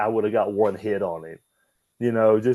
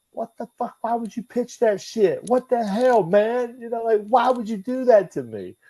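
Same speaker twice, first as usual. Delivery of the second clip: retold outrage; 13 semitones higher than usual. Retold outrage at JBL's pitch.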